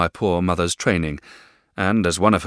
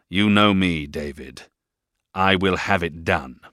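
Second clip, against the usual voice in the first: louder, commanding and cold; stern stepfather command; 4 semitones higher, 6 dB louder than usual. A narrator's reading. Stepfather orders David to obey, commanding. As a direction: lay down the law. Mr Murdstone voiced.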